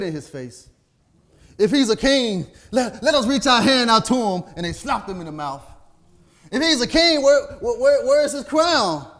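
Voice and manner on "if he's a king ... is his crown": loud, high mocking cry of the soldiers; preaching at full force. Preacher acting out the soldiers' taunts, loud and high.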